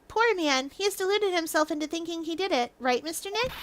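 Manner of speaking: high, softened pitying voice; sympathetic assistant. Acted. Assistant tells Mister Nick about the deluded man, pitying. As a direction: speak softly and high, then check for agreement.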